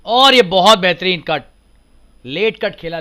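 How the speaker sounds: loud burst at a late cut; excited cricket commentary. Real, not acted. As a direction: punch out the shot call.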